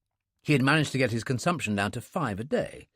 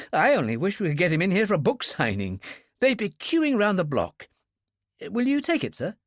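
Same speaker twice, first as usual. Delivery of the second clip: much higher, gushing delight; a young fan of the author; enthusiastic clerk; 7 semitones higher than usual. Acted.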